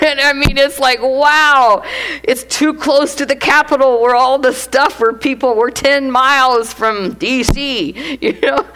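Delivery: laughing at her own worry; amused chatter. Real, not acted. Laughing about living near DC.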